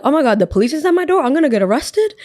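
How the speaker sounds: high, fast panicked exclamation; acted-out freak-out at the police arriving; retold panic.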